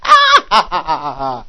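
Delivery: real laugh; burst of laughter. Real, not acted. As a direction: break into a shouting laugh. Laughing at theologians' readings.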